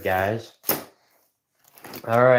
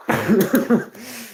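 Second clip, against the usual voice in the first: high giggle; 12 semitones higher, 3 dB louder than usual. Real, not acted. Laughing at mom's notes.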